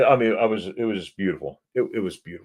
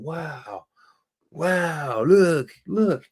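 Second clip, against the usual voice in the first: awed wow; 8 semitones higher, much slower than usual. Retold or imitated. Awed by scenery while vlogging.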